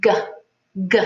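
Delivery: hard "G" from the back of the throat; over enunciating. Shown as a demonstration. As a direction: spread the mouth and say "G" slowly. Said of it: "watch my lips as I say it".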